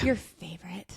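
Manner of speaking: teasing whisper. Real, not acted. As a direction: whisper it slyly. Teasing about exorcisms.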